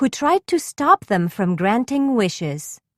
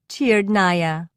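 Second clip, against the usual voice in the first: plain reading voice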